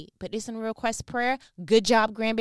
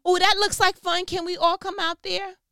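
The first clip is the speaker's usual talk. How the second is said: put-on much higher and louder, childlike excitement; mock excited tourist; 8 semitones higher, 8 dB louder than usual. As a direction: gush like it's a fun ride. Mock casual disciple asking.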